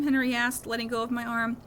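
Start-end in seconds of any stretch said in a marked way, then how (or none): none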